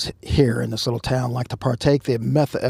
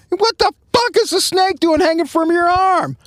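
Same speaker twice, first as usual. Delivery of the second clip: high, startled mock cry; shocked outburst. Chief spotting snake on suspect's arm, shocked high cry. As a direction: retell the chief's disbelief, pitched way up. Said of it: "chief yelling again".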